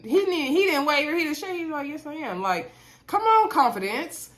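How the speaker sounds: high, loud and thrilled; excited praise. Real, not acted. Vlogger gushes over Brett's steady answer, high and excited. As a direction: gush about how sure he sounded.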